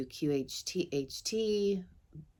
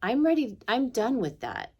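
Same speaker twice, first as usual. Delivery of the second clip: higher-pitched, decided announcement; resolved declaration; 8 semitones higher, 4 dB louder than usual. Retold or imitated. Speaker telling guides they are ready, resolved. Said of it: "And I said".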